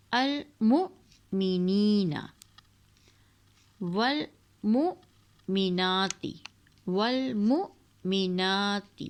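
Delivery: المؤمنین very slow, glottal jolt on the hamza; slow recitation with catch. Shown as a demonstration. Teacher reciting المؤمنین with a hamza jolt. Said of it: "جھٹکا ہم یہاں دیں گے".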